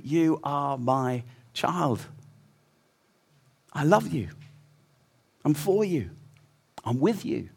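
soft, slow, gentle declaration of love; tender father's voice. Acted. God speaking over each listener, soft and slow. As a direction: speak it like a father bending over his child.

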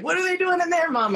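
high, eager dog voice; dog's inner voice. Retold or imitated. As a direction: voice the curious dog asking Mom. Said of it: "her little head's looking back like".

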